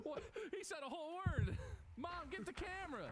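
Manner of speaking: hammed-up parental pride; mock proud parent. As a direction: gush like a parent at a first word.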